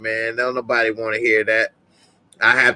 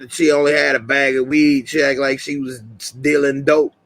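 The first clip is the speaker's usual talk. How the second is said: ordinary talk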